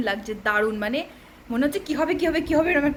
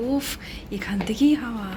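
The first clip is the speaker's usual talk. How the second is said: whisper; 4 semitones lower than usual. Real, not acted. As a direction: whisper it on the dark roof. Whispering at night on roof. (ফিসফিস করে)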